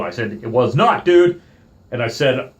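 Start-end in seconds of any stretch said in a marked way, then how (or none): 0.4-1.4 loud high indignant denial; retold snap-back. Arguing with the park worker, loud indignant denial. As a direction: bite back hard on dude.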